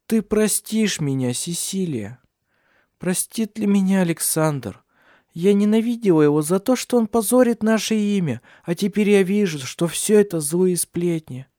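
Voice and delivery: high, impassioned plea for forgiveness; tearful remorse. A narrator's reading. Ursula begging Cecilia's forgiveness, tearful remorse. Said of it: "Слёзы бежали по щекам Урсулы".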